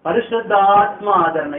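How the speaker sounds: loud, high, ringing preacher's cry; full-force preaching. Acted. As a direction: proclaim it to the whole hall.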